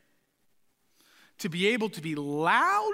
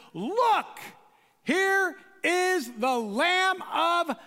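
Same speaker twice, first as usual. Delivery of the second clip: slow, drawn-out excited shout; joyful herald's shout; excited proclamation; 6 semitones higher, much slower than usual. Acted. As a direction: call out in delight. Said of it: "He's excited about who Jesus is".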